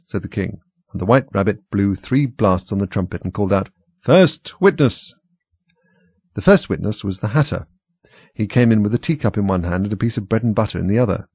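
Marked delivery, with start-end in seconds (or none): 4.0-5.1 slow, drawn-out herald's call. Acted. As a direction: announce it like a court herald. Rabbit calls out witness.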